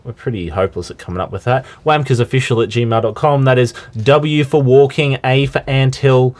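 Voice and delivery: ordinary talk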